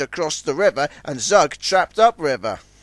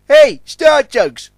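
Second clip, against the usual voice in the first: loud, slow call across the water; 12 semitones higher, 10 dB louder, slower than usual. A narrator's reading. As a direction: hail them from afar. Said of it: "called Billy".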